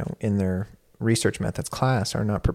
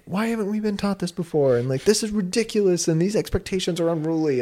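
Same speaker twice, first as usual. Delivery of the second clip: acted exasperation, pitched well up; voicing frustration; 9 semitones higher, 3 dB louder than usual. Venting at unfair class expectations, mock-frustrated. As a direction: complain like an overwhelmed student. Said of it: "there's these feelings of just".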